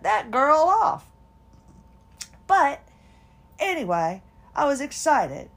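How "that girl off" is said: loud, slow, fed-up "oh my God"; fed up and wanting it off now; exasperated outburst. Retold or imitated. To a stranger's annoying voice, irritated loud outburst. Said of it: "and you're just like".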